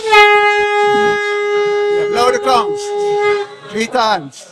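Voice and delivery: high, loud call to the drummers; shouted cue. Real, not acted. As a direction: shout the cue across the hall. Calling out cue to musicians.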